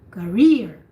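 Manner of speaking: career with ka barely sounded, rear loud and clear; a teacher's model career; stress on rear. Shown as a demonstration. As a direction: give career its weight on rear. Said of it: "the rear is the one that you hear".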